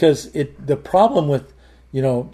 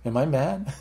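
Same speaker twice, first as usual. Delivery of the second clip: softly retold self-check; pulled back; 8 dB quieter than usual. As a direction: drop your volume and question yourself. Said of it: "I pull back and I go".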